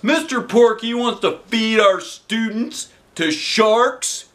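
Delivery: high, very slow disbelief; stunned disbelief. A narrator's reading. Principal stunned by the idea, high slow disbelief. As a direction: drag it out in shock. Klutz voiced.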